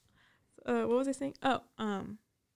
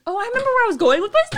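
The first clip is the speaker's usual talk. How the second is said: high, loud, fast rush of excitement; excited; 11 dB louder, much faster than usual. Real, not acted. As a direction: burst out remembering. Sudden recall, excited.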